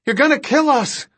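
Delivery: high-pitched frantic cry; panicked protest. Acted. King fears the driver will crash, high and panicked. Terrified passenger protests reckless reversing.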